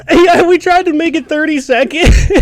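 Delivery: high, loud laughing words. Real, not acted. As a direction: crack up mid-sentence. Laughing while mouth burns.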